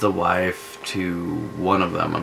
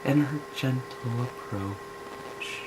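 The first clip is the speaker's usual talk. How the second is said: soft, higher and slow; soft gentle voice; 7 semitones higher, 7 dB quieter, slower than usual. Shown as a demonstration. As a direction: ease it out gently and softly. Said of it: "with her soft speaking voice".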